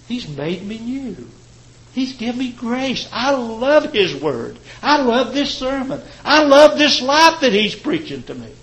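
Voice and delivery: high, loud, rising praise; preaching at full force. Acted. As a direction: preach it with swelling joy.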